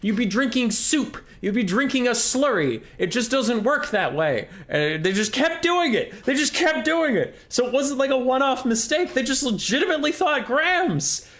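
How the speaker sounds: high-pitched, worked-up comic outrage; exasperated rant. Acted. Chemist fuming that podcast hosts thought grams, exasperated rant. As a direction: rant in squeaky, incredulous indignation. Ranting about the podcast's mistake.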